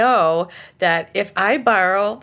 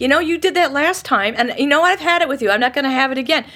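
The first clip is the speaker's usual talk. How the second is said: loud, high-pitched fed-up rant; angry venting, all out; yelling; 7 semitones higher, 5 dB louder, faster than usual. Shown as a demonstration. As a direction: unload on the imagined offender. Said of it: "I'm just yelling at this imagined person".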